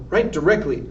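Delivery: usual speaking voice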